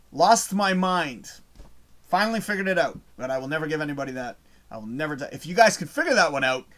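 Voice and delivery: ordinary delivery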